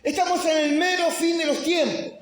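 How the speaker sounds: high preaching shout on ESTAMOS. Acted. Pastor proclaiming the end of times, full preaching shout. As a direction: hammer it home to the congregation. Repeat of line, now shouted.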